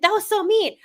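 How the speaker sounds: high-pitched playful reproach; mock scolding. [name] telling off Malik, high mock reproach.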